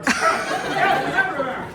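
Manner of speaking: loud, high, stretched-out call; playful shout. Pretend. Teacher telling student to throw calcium up, playful shout. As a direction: fling the words out big and slow.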